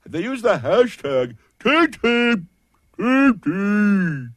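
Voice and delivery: bizarre creepy voice, high and slow; freaky weird. Shown as a demonstration. Host demonstrating hashtag voices, freaky weird. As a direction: squeal it out slowly like a creepy weirdo. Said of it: "freaky weird".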